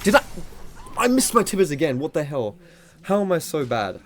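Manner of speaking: high, loud exasperated cry at himself; frustrated outburst. Real, not acted. Misses his ultimate again.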